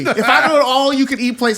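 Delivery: ordinary talk, nothing marked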